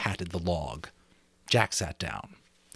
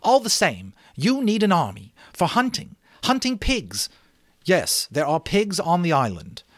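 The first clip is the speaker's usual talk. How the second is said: put-on much higher, louder pushy boy's voice; Jack breaking in; 9 semitones higher, 5 dB louder than usual. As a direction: cut in eager and insistent. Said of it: "Jack broke in".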